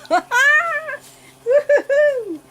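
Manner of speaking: high drawn-out delighted whoop of a laugh; excited squealing laugh. Real, not acted. Unboxing her new doll, a high excited whooping laugh. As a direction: let out a long, high squeal of glee.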